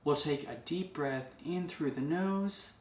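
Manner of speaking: calm wellness-host delivery; guided meditation. Acted. Leads a meditation video.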